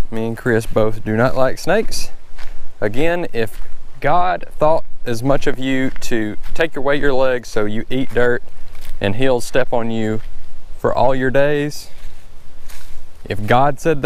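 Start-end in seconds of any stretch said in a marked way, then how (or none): none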